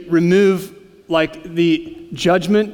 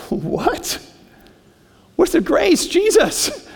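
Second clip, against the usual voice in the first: much higher, disbelieving question; incredulous; 11 semitones higher, much slower than usual. Acted. Preacher voicing the congregation's shock to Jesus, incredulous. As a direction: ask it as if shocked. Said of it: "mock protest at the ending".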